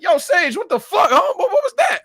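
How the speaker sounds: high, loud laughing disbelief; hammed-up shocked buddy; shocked friend. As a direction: shout in outraged surprise. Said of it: "he's like".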